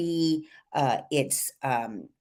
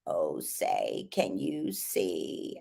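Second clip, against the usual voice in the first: much lower, slow, soft creaky fry; smoker's creak on every word; exaggerated vocal fry; 11 semitones lower, 9 dB quieter, much slower than usual. Shown as a demonstration. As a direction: sound like a chain smoker. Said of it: "I'll try to exaggerate it".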